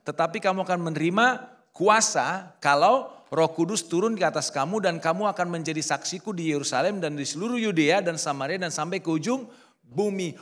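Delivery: loud, slowed proclamation of scripture; booming scripture reading; preaching scripture. Acted. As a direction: declare the verse to the whole church. Reads scripture at full force.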